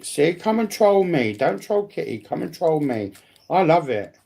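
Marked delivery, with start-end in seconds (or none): none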